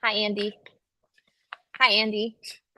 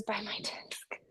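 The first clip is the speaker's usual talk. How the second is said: slow whisper; 6 semitones lower, 19 dB quieter, slower than usual. Real, not acted. Showing desk wires to viewers in a slow whisper.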